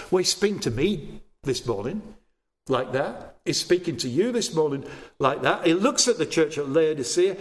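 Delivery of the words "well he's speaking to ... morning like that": normal talking voice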